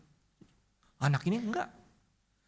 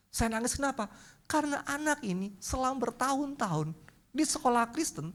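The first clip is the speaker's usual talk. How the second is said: ordinary delivery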